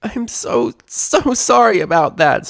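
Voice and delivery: high, slow, teary filly voice; sorry and close to tears; little filly sniffling out an apology. Acted. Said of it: "Sweetie Belle exclaimed, sniffling".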